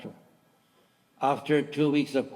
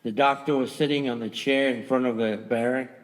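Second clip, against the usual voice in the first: ordinary delivery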